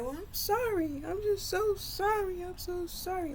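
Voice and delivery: high, wailing imitation of tearful sorry; crying apology. Sister apologizes to Willie, mock crying. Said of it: "she was just crying".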